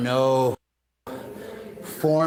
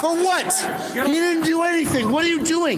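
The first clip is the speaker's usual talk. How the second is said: alarmed yelling; 12 semitones higher than usual. Real, not acted. Onlooker protesting the arrest in a high, frantic yell. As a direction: shout it at them in real panic. Bystander yelling as man grabbed.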